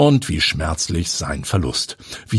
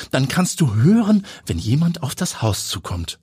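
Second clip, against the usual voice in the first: higher woman's voice, calm and sensible advice; Pia's voice; 9 semitones higher than usual. A narrator's reading. Pia advises Heidi on safety, lightly voiced firm friend. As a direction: reason with a frightened friend.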